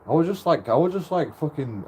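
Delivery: normal talking voice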